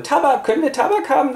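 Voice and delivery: very high squeaky mock plea; begging voice. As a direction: beg like a greedy family member. Familie bettelt um Tabak. (sehr hohe Bettelstimme)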